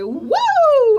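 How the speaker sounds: put-on high, loud drunken whoop; hammed-up tipsy "whoa"; drunken flailing. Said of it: "drunkenly flailing in the crowd".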